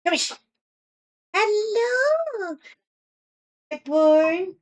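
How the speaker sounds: high crooning pet voice; light sing-song dog talk. Real, not acted. Cooing at her dog.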